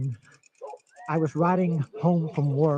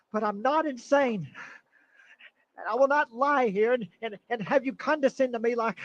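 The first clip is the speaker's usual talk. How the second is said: rising, higher, agitated insistence; nervous patient snapping at his doctor; desperate protest; 6 semitones higher than usual. Acted. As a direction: fight back against being called crazy.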